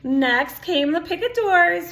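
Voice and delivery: plain reading voice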